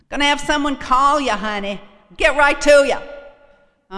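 loud, high Boston tough guy; Boston accent. Retold or imitated. AA volunteer promising a callback, loud Boston accent. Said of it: "That's how they talk up there".

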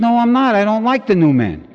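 loud and high, refusing flat out; defiant refusal. Retold or imitated. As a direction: shout no at the sponsor. Arguing with sponsor, refusing.

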